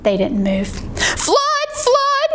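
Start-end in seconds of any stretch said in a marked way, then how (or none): 1.2-2.3 very high mock alarm; false alarm, pitched right up; cry of alarm. Jack tricking the birds, high alarm cry. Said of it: "he cried".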